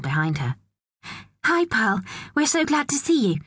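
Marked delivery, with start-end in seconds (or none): 1.4-3.4 high-pitched, eager greeting; gushing welcome. A narrator's reading. Kathy welcoming Pearl at the front door, gushing welcome. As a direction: greet a child with lifted, delighted voice.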